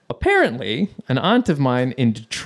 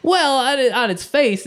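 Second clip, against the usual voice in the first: mock defensive; 12 semitones higher, 4 dB louder than usual. Sheepish defence to angry mom.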